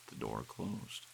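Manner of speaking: plain reading voice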